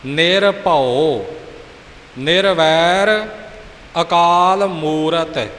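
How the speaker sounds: each word drawn out slowly and clearly; slow model recitation. Shown as a demonstration. Teacher models the Mool Mantar words slowly. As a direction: recite word by word, unhurried and exact. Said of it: "ਇਉਂ ਪੂਰਾ ਉਚਾਰਨ ਕਰਨਾ ਹੈ".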